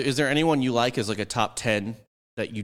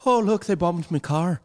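mock innocent; 8 semitones higher, 4 dB louder than usual. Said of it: "So you're like".

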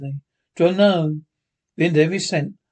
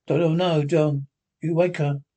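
ordinary talk, nothing marked

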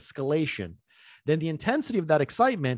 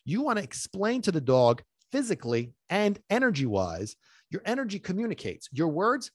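ordinary delivery

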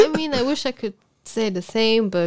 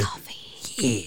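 soft breathy echo of the word; whispered echo; 13 semitones lower, 8 dB quieter than usual. Pretend.

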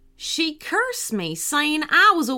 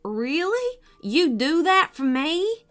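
higher, hopeful farmer's question; 6 semitones higher than usual. A narrator's reading. Farmer asking if they will face the witch, hopeful. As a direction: ask it pitched up, daring to hope. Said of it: "looked up hopefully".

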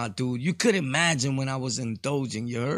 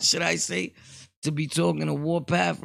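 usual speaking voice

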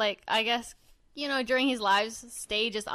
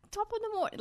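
bad British accent; 12 semitones higher, 5 dB quieter, much faster than usual. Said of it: "asked him to do a British accent".

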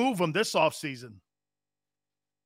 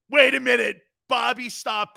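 sharp, louder call to wait; loud interruption; 5 semitones higher, 5 dB louder than usual. Real, not acted. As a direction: cut them off loudly. Host cutting in, loud.

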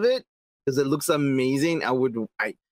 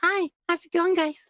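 put-on very high little-kid voice; mock a squeaky child's greeting; tiny kid voice, pitched way up; baby-voiced Donatello; 12 semitones higher than usual. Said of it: "But his voice is like".

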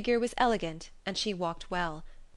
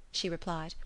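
plain reading voice

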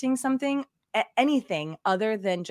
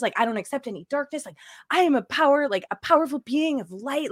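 higher, louder, gushing spiritual rapture; mock ecstatic; 5 semitones higher, 4 dB louder than usual. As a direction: gush like a blissed-out light being. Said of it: "but it was just like".

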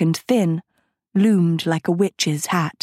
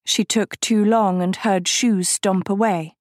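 usual speaking voice

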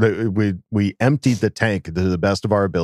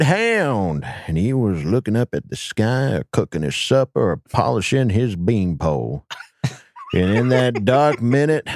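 creepy voice. Acted. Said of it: "that's a really creepy voice".

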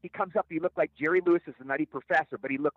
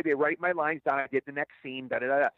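usual speaking voice

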